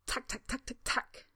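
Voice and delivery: soft clicking tack sounds; beetle clicking. A narrator's reading. Beetle Stanley clicking to the boys. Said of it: "Stanley offers".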